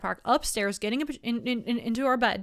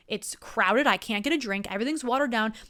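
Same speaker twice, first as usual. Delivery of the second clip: normal talking voice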